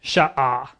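careful sha'ah; Hebrew word. Shown as a demonstration. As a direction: draw out sha'ah, ah-ah. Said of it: "This comes from the word".

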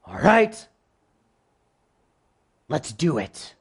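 lightly voiced pride, bracing itself; determined pride. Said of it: "pride says".

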